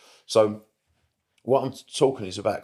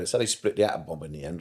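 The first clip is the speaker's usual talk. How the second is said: ordinary talk, nothing marked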